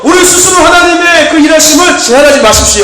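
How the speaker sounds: fiery preaching. Acted. Pastor urging the congregation to stay ready, fiery preaching. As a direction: preach it hard and wait for the amens.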